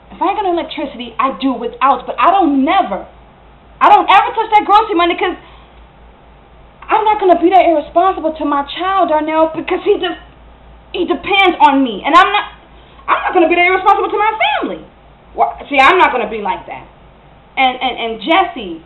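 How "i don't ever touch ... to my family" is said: worked-up, higher-pitched protest; heated self-defence to a partner; indignant insistence. Acted. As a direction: stand your ground as a mother, voice climbing. Acted quarrel, defends care of child.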